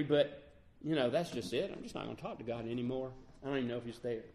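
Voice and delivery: low, soft, defeated voice; mumbling it like you're giving up; a hurt believer quietly shutting God out. Retold or imitated.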